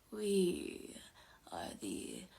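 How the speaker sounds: very slow, low, whispery wraith; eerie, soft, otherworldly menace; possessing spirit. A narrator's reading. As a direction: draw every word out in a ghostly hush. Possessing spirit announces itself.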